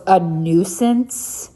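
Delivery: "nuisance" drawn out slow with a rising question; slow mocking echo of "A nuisance?"; slow disbelieving echo. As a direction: repeat "A nuisance?" slowly, incredulous. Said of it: "And he goes".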